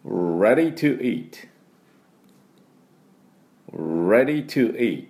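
"Ready to eat" slowly, with a drawn-out initial English R; repeat-after-me model. Shown as a demonstration. Teacher leading learners to follow, slow pronunciation model. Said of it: "私の後についていきましょう".

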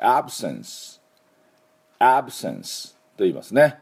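"absence" said slowly with a broad ae first vowel; teacher demo of "absence", ae vowel stressed; absence pronounced. Shown as a demonstration. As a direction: model "absence" with the stress and open ae on the first syllable.

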